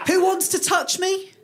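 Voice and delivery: high, over-keen offer; cocky student. Acted. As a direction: put it to the room eagerly. Acts boastful student bookworm.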